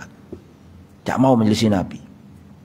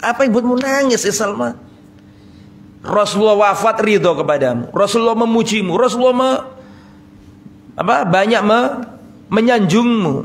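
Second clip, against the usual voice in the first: higher, urging voice of a friend at the sickbed; Sa'ad consoling; 7 semitones higher than usual. Acted. Sa'ad urging his weeping sick friend, higher pleading voice. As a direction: lift him with praise, pleading. Sa'ad asks Salman again.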